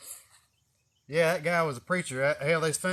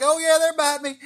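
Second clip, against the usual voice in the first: loud, bright boast while bitten; loud show-off reply; 12 semitones higher, 11 dB louder than usual. Retold or imitated. Snake catcher shouting back up to the bridge crowd, loud. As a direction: belt it out to the crowd, proud.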